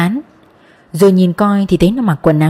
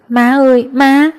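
put-on higher-pitched child's whimpering call; tearful whimper; 5 semitones higher, slower than usual. A narrator's reading. Frightened little boy calls for his mother, whimpering. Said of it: "khóc thút thít mà kêu".

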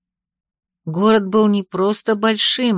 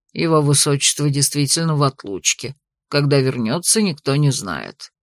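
a gentleman bowing politely; polite older man; 7 semitones lower than usual. A narrator's reading.

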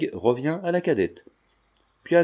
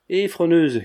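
raised, higher call to a friend; 6 semitones higher, 5 dB louder, slower than usual. A narrator's reading. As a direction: call his name out louder and higher. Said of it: "cria Binos".

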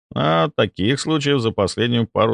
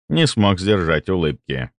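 plain reading voice

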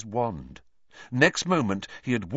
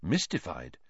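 ordinary delivery, nothing marked

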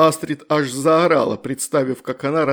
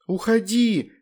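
much higher shouted command; shouting; 8 semitones higher, slower than usual. A narrator's reading. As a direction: cry out to banish it. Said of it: "выкрикнула Вероника".

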